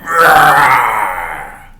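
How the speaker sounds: a loud rumbling engine roar; loud roaring engine noise. Acted. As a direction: roar like a supercharged mini.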